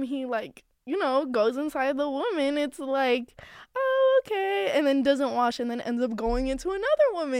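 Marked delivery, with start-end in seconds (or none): 3.8-4.7 drawn-out, high "oh, okay"; mock acceptance. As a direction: go high and incredulous. Mock reaction to gross story.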